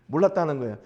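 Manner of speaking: quick, laughing 몰랐다는 거예요. Real, not acted. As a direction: laugh it out fast. (몰랐다는 거예요를 웃으며 빠르게)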